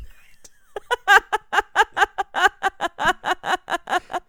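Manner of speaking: high, rapid, pulsing belly laugh; loud cackle. Real, not acted. Cracking up at the coworker's awkward silence, loud cackling. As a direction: burst out laughing and keep it rolling.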